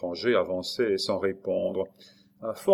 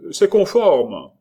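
loud, high official pronouncement; raised voice; 8 semitones higher, 11 dB louder, slower than usual. A narrator's reading. Brigadier approving the description aloud, loud official call. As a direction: announce it slowly and loudly. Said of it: "à haute voix".

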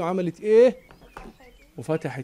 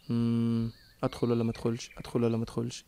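hesitant whisper to herself; 6 semitones lower, 10 dB quieter, much faster than usual. Acted.

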